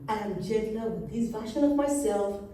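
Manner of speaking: expressive spoken-word with dramatic pauses; deliberate poetry reading, key words drawn out; poem recitation. Acted. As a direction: perform it as a proud personal poem.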